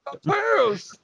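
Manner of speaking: very high, hammed-up shocked squeal; mock pearl-clutcher. Joining in the witness joke, mock scandalized squeal.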